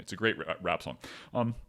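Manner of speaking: normal talking voice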